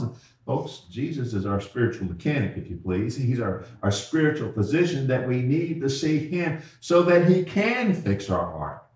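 ordinary talk

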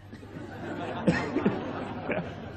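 airy wheezing laugh; breathless laugh. Real, not acted. Preacher laughing at his own fright. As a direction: laugh with no voice, shoulders shaking.

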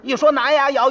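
ordinary talk

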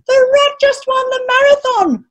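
high-pitched, sing-out cheer; elated, ringing high voice; joyous crowd. A narrator's reading. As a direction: proclaim it like a jubilant crowd. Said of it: "the joyous crowd was singing".

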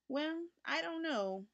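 slow, hesitant mother's voice; uncertain mother. A narrator's reading. Mother hesitates over buying the kitten, slow and unsure. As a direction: waver as if not yet decided. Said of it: "said his mother uncertainly".

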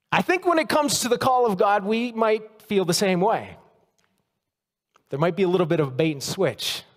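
ordinary talk, nothing marked

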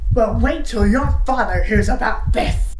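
loud, slow, drawn-out threat; acted angry mom, weighting every word; threatening mom. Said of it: "she says the worst thing".

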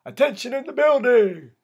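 high, loud tannoy-style call; guard's announcement. A narrator's reading. Tower guard announcing day room to inmates, loud announcement. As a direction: bellow it out to the whole building. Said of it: "tapped on the microphone to signify an announcement".

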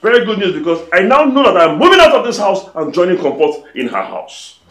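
sarcastic. Acted. Sarcastic angry husband.

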